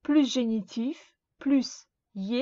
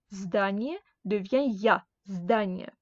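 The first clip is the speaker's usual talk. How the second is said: ordinary talk, nothing marked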